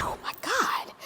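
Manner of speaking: breathless, whispered oh my God; stunned whisper. Retold or imitated. Teacher amazed her former pupil is grown, whispered. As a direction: gasp it out in disbelief.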